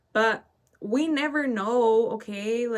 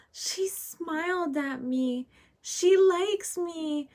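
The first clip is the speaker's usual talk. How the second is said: high, slow, thrilled gasp of delight; delighted gushing; 8 semitones higher, much slower than usual. Retold or imitated. Old lady to companion, high slow delighted gushing. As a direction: gush like she just made a new friend.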